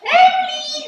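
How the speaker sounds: long, high, loud call across the field; calling a sheep. Retold or imitated. Farmer at the gate calling her sheep Emily, loud high call. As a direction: belt the sheep's name out to the far end of the field. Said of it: "as I'm going up to the gate and going".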